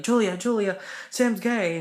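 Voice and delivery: high, loud excited call; excited announcing. Retold or imitated. Rachel telling Julia the news, excited call. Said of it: "and she's like".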